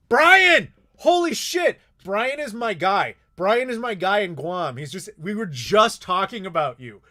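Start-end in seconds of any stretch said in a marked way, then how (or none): 0.1-4.8 much louder, higher, thrilled greeting; excited shout. Real, not acted. Spots his friend Brian in chat.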